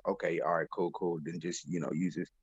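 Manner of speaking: low, soft, easygoing concession; relenting God. Retold or imitated. Speaker voices God giving in to the prophet, soft relenting. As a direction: give in quietly and casually.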